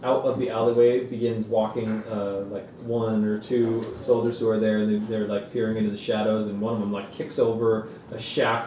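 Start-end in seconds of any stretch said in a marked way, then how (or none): none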